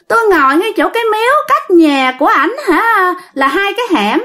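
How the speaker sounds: put-on high, simpering girlish voice; coy woman spirit. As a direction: coo it like a lovestruck ghost girl. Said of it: "nói giọng đàn bà luôn".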